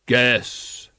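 slow, hollow voice of Death; grim reaper voice. A narrator's reading. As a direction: speak as Death, grave and unhurried. Death speaks from the shadows.

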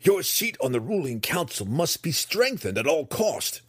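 high, forceful, urgent warning; fervent high-pitched insistence; insistent daughter. Acted. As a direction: press the point on your mother.

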